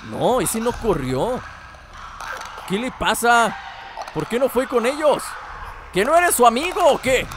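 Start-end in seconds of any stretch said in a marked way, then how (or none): 2.7-7.3 mock indignant. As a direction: tease him like a scolding parent. Scolding a deaf clicker.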